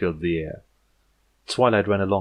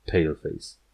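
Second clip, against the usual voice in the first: plain reading voice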